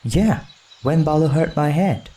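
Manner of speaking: put-on high boy voice, sulky; young boy complaining; Mowgli the boy.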